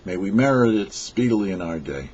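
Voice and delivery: ordinary talk, nothing marked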